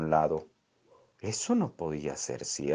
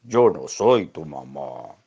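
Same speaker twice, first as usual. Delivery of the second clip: tiefer, lauter, langsamer Hund; Hundestimme; 3 semitones lower, 6 dB louder, slower than usual. Acted. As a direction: sag es tief und gedehnt als Hund. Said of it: "El perro dijo".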